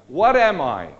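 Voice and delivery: normal talking voice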